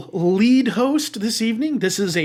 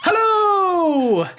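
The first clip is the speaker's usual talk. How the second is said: big bright hello; shouted greeting; 10 semitones higher than usual. Acted. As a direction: call it out like a host. Show opening call.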